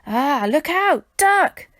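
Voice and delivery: loud, high cry of alarm rising to a yell; urgent warning shout. A narrator's reading. Mister Smith pranks Stan with a false eagle warning, shouting. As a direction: yell a warning to duck, faking panic.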